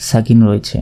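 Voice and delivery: ordinary talk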